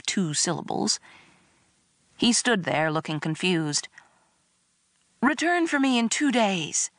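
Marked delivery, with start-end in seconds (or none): 5.2-6.9 high, theatrical plea; begging. A narrator's reading. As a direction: implore him with put-on devotion. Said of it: "I begged him".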